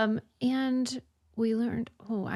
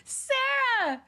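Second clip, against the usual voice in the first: excited; 13 semitones higher than usual. Real, not acted. Sudden recalled excitement.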